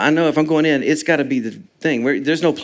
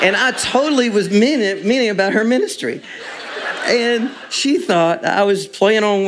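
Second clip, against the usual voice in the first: laughing; 8 semitones higher than usual. Real, not acted. Laughing recalling text mixup.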